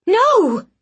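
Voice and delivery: high, loud shocked yell; sudden piercing refusal. Acted. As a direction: shout it to stop him cold. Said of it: "I yelled".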